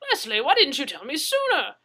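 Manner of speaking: very loud, high jolly Englishman; booming hearty voice. Acted. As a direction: boom out the welcome. Brewer booming welcome.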